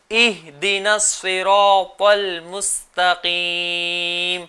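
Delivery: اهدنا الصراط المستقيم drawn out very slowly, each letter articulated; تلاوت آہستہ، ہر حرف صاف; slow careful recitation. Shown as a demonstration. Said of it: "کس طرح سے اس کی ادائیگی کرنی ہے".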